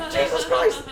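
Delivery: laughing mock terror; startled. Cowering on the bench as Roy roars, a laughing yelp.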